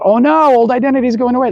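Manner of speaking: high-pitched mock panic; fearful mind. Mind facing the loss of its old identity, panicking. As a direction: wail it in mild mock alarm. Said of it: "your mind going through the fear".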